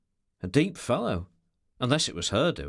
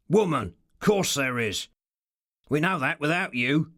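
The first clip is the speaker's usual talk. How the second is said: rough voice, mildly raised in anger; rough, angry; 3 dB louder than usual. A narrator's reading. Ricardo answers the creature with calculated contempt, rough and angry. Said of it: "said in a rough, angry voice".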